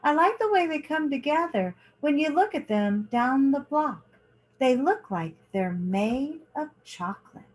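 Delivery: lively picture-book reading, lingering on each page; reading to children. A narrator's reading. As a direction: read it like story time for kids. Reads picture book aloud.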